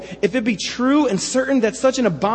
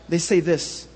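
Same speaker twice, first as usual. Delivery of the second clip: ordinary delivery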